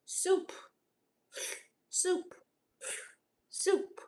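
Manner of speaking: high, soft little one-word loop; NPC reaction. Shown as a demonstration. Streamer demonstrating her gift reaction, robotic NPC repeat. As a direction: chirp it like a looping game character. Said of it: "I react like an N P C".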